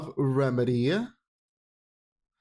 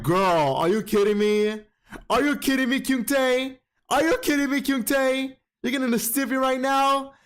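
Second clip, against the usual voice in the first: mock outrage; 7 semitones higher than usual. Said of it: "I was like".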